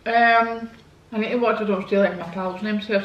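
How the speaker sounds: ordinary talk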